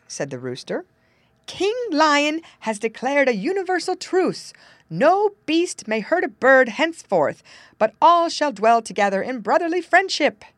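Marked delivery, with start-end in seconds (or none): 1.5-10.5 raised, louder storybook herald voice; proclaiming fox. Acted. Fox telling the rooster of the truce, grand proclamation. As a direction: announce it grandly as a royal decree.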